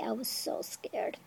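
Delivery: slow, breathy confession in a whisper. Real, not acted. Telling viewers about her old fear, in a whisper. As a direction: drop to a hush to admit it.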